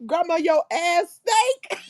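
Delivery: slow, laughing half-yell; mock yell lost in laughter; laughing shout. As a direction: drag it out while cracking up. Retelling the baby's line, cracking up.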